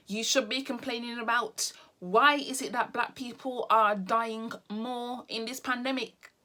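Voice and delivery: normal talking voice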